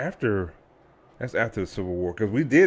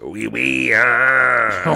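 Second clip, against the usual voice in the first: silly French interjection, swelling louder; mock French accent; 6 dB louder, much slower than usual. Co-host echoing the French player joke, mock French accent. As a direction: play along with a cartoon French accent. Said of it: "the French American".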